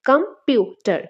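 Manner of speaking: slow কম - পিউ - টার, leaning on পিউ; computer in separate beats, stress on পিউ; stress shown by syllables. Shown as a demonstration. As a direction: press the second syllable of computer. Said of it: "এখান থেকে stress পড়ছে".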